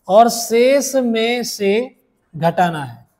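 very slow, word-by-word dictation. Shown as a demonstration. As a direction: spell the rule out slowly, pausing between words. Teacher dictates rule for note-taking.

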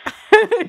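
loud, high burst of laughing; burst of laughter. Real, not acted. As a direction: crack up loudly.

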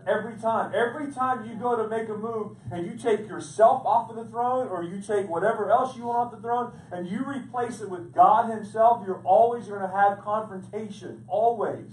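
preacher rising to a fervent pitch; full force preaching. Acted. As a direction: drive the question home to the congregation.

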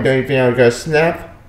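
ordinary delivery

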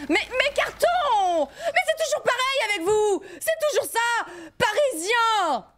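high, slow, wailing despair; melodramatic wail. Acted. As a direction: wail over the ruined boxes. Melodramatic wail over boxes.

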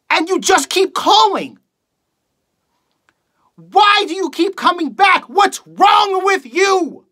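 high-pitched, shouted theatrical fury; furious outburst. Acted. Radio host begs callers to stop calling, furious shouting. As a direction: explode at them, voice pushed up high. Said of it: "reading a monologue for you guys".

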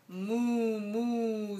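lightly voiced mooing; a hint of a lowing cow. Parent reading farm book to child, gentle cow moo. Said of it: "says the cow".

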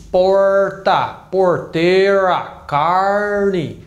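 slow, rolled caipira R; caipira accent. Shown as a demonstration. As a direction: drag out the curled R like a country farmer. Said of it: "O caipira fala assim".

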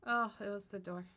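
usual speaking voice

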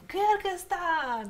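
long drawn-out shout; pageant shout. Retold or imitated. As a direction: call out the country name, stretched. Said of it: "знаем Мисс Кыргызстан по крикам".

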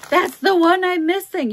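imitating a thrilled buyer, high and loud; loud, high-pitched mock excitement; excited buyer. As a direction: act out an overjoyed collector's cry. Acting out a delighted buyer's reaction.

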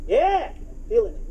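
normal talking voice

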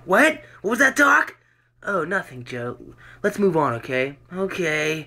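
acted doctor and patient.

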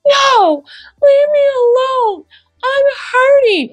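put-on high, whiny child pushing away help; upset child. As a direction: cry it out like a hurt little kid.

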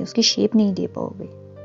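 ordinary delivery

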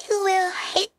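hiccup cutting into speech. Real, not acted. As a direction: hiccup before the words. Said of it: "get rid of my hiccups".

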